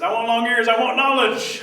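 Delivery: loud, high rabbit demand; insistent demand. Acted. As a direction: pipe up firmly for your reward.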